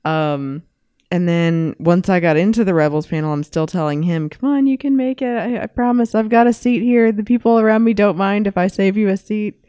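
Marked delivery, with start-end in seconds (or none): 4.3-9.6 high, bright pleading; hurried high urging to her husband; eager urging. Retold or imitated. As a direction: coax him to hurry over, voice pitched up. Urging husband by phone to hurry.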